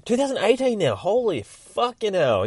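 high, loud exclamation of disbelief; real shock at the date; astonished realisation. Real, not acted. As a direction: blurt the year high, then stretch out the swearing. Realises how long ago it was.

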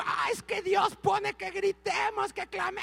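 agudo, lento, como excusándose a gritos; imitación exagerada. Retold or imitated. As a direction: hacerse el justificado con voz aguda y lenta. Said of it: "Y yo". (pastor se imita justificando el grito, agudo y lento)

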